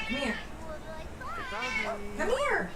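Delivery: very high, soft coaxing call; coaxing pet. Real, not acted. Owner coaxing frightened cat closer, high soft call. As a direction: coax the kitty over gently. Calling the scared cat over.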